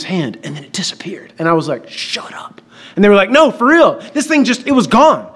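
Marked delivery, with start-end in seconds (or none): 1.9-2.6 hushed, disbelieving whisper; stunned whisper. Retold or imitated. Stunned reaction to vanished handkerchief. 3.3-5.3 put-on high, eager kid retelling; excited insistence. Kids insist the trick was real.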